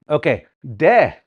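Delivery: ordinary talk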